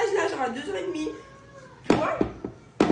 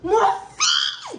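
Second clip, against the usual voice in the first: high shouted call, laughing; sudden high laughing shout; shouting her son; 8 dB louder than usual. Retold or imitated. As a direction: yell her son's name across the playground. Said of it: "j'ai crié".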